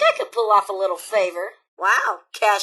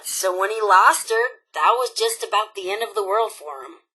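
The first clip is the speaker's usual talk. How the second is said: ordinary delivery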